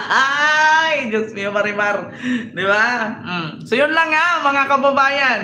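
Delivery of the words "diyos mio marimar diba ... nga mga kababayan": usual speaking voice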